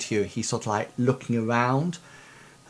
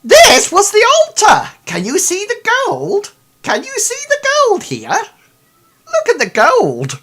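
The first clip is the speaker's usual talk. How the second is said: much higher, louder squeal; frantic excitement; 12 dB louder than usual. Acted. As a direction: shriek with manic joy. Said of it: "turns around to you and says".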